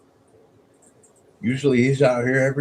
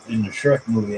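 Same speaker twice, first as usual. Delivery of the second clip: ordinary talk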